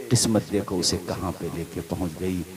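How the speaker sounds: almost breathing the story to the crowd; soft, tense narration at the doorstep; hushed storytelling. Acted. Hushed narration at mother's door.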